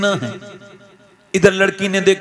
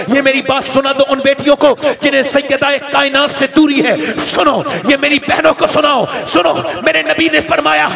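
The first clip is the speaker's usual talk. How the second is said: fiery preaching; 6 semitones higher than usual. Acted. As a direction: thunder at the crowd. Loud sermon address. (जोशीला ऊँचा was)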